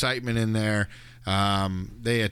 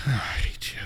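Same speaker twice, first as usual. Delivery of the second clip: breathy mock whisper of hatred; 3 semitones higher than usual. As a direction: whisper it with contempt. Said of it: "she looks at him like".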